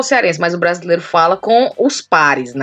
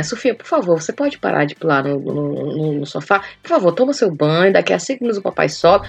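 softer, lower, gentle request; very polite; 4 semitones lower, 4 dB quieter than usual. Retold or imitated. British dad asking daughter off the sofa, very polite and soft. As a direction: imitate an extremely courteous, calm dad. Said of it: "que é muito polida".

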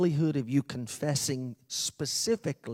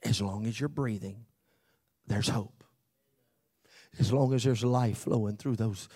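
ordinary talk, nothing marked

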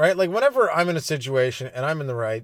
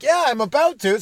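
excited; 11 semitones higher, 5 dB louder than usual. Retold or imitated. Inner voice deciding to fight.

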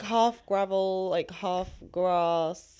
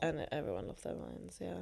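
much softer, trailing repeat; quiet echo; 2 semitones lower, 13 dB quieter than usual. Real, not acted. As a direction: murmur it back to yourself. Quiet echo of her own line.

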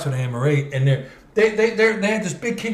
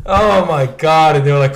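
usual speaking voice

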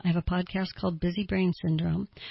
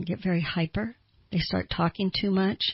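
ordinary talk

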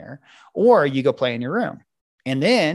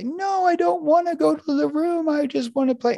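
put-on much higher whiny child voice; whining kid. Parent imitates child refusing to go to room, whiny. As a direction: protest like a kid who wants to play. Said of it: "most kids are gonna say".

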